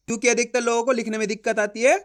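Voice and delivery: ordinary talk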